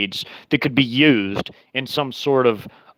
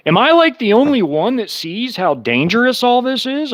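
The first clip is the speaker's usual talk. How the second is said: much higher, incredulous rhetorical question; exasperated; 10 semitones higher than usual. Real, not acted. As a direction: throw the question up in disbelief.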